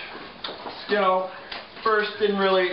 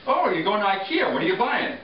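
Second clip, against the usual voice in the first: lightly voiced shoppers, pitched higher; mock shopper chatter; 7 semitones higher than usual. Mocking people off to wander IKEA, higher shopper chatter. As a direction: imitate store-goers in a raised, chatty voice.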